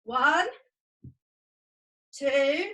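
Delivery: loud exercise count, one beat per jump. Real, not acted. Teacher counting children through star jumps, counting out. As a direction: call out each count loudly.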